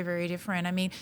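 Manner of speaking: normal talking voice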